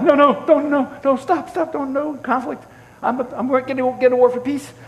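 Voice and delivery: high, hurried mock pleading; imitated high-pitched anxious peacemaking; panicked pleading. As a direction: frantically try to head off a fight. Retold frantic pleas to avoid conflict.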